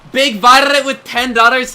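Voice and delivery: high, loud and slow hype; excited donation read. Real, not acted. Streamer reading a super chat, loud and excited. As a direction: hype the donor's name up.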